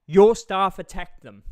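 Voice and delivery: loud, slow furious shout; angry shout. Real, not acted. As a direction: slam it down hard and slow. Angry rant rebutting the staff.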